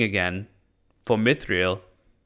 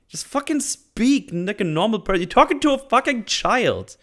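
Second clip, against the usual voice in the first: squeaky, much higher, exasperated ranting; shrill rant; 12 semitones higher than usual. Real, not acted. Viewer ranting at Galadriel's flowery speech, squeaky and far higher. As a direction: rant way up high at the flowery talk.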